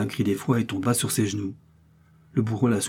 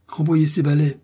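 raised, louder command; urgent order; 6 semitones higher, 5 dB louder than usual. A narrator's reading. A man ordering that the valets be sent away, urgent order. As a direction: order them away, pitched up.

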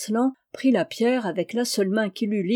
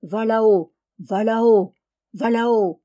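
louder rhythmic chant of a mill wheel; louder mechanical chant of a mill; 4 dB louder than usual. A narrator's reading. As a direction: drone it like a turning millwheel. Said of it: "Son moulin disait".

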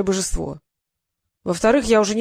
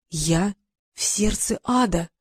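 horrified; slower than usual. Acted. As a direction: let the dread sink in. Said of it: "с ужасом сказал Трикс".

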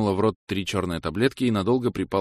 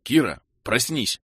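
growl; 6 semitones higher, slower than usual. A narrator's reading. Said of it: "рыкнул я".

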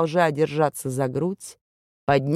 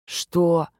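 barely able to speak. Acted. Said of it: "еле выговорил он синими губами".

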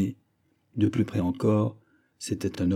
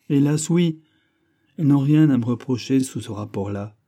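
plaintive, raised lament; sighing; 5 semitones higher, 5 dB louder than usual. A narrator's reading. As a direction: complain with a touch of a sigh. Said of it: "dit Mousqueton avec un soupir". (plainte avec un soupir)

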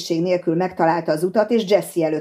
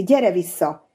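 ordinary delivery

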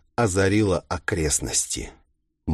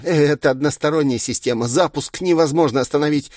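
higher, hurried villain voice; glib villain; 7 semitones higher, faster than usual. A narrator's reading. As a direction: explain it away with mild mock helplessness.

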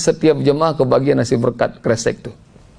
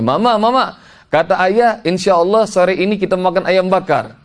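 put-on higher-pitched child's announcement; excited child reporting; 5 semitones higher than usual. Daughter tells Mama what ayah promised, high excited child's voice. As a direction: tell Mama the news like an eager kid. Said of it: "dia ngomong ke mamanya".

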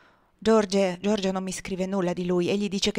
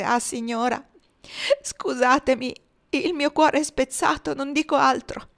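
higher, grief-stricken plea; sobbing; 5 semitones higher than usual. A narrator's reading. Chloe on her mistress's shoulder, a touch of sobbing grief. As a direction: let the heartbreak push the voice up. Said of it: "singhiozzando forte".

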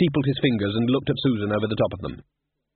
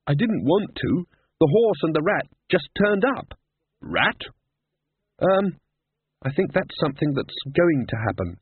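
put-on higher, flustered girl; Susan, defensive; 6 semitones higher than usual. Susan tells Death about the horse, higher and flustered. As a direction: protest a little too quickly.

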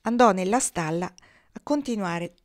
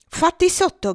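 high, loud dare; shouted challenge; 10 semitones higher, 6 dB louder than usual. A narrator's reading. Angry cow dares the boy to come closer, shouting. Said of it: "gridava la rosa".

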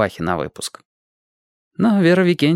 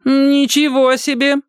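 much higher, slow snide remark; sarcastic; 4 dB louder, slower than usual. A narrator's reading. Kostik sneers at Tina, high, slow and sarcastic. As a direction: needle her with sarcasm. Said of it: "язвительно заметил Костик".

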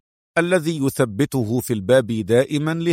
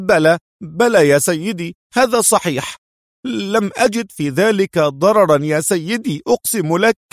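high, raised, grovelling voice; abject pleading; 7 semitones higher, 4 dB louder than usual. A narrator's reading. Humbled man confessing to Adham about the money, begging. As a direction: beg him to believe you. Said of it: "قال الرجل بمذلة وتوسل".

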